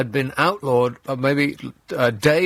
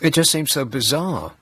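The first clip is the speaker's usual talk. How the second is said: ordinary talk, nothing marked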